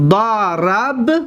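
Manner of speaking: ضربنا said very slowly, each syllable drawn out; model word spelled out. Shown as a demonstration.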